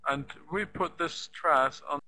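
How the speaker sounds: normal talking voice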